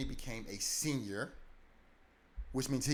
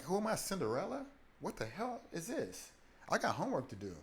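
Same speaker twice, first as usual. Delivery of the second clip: much higher, outraged sarcasm; indignant teen; 8 semitones higher than usual. Retold or imitated. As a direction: protest like an overworked Cinderella. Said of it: "I'm like".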